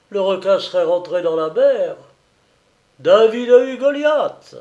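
put-on high, loud boasting old seaman; proud old sailor. A narrator's reading. Old sailor boasting to monsieur Frémeuse about the kill, proud. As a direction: crow about the torpedo like a proud old salt.

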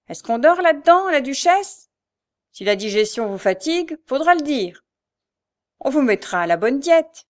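mocking shout. A narrator's reading. Mother shouts through the door at the girl, mocking and loud. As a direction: pound the door and jeer. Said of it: "cognait ... en criant".

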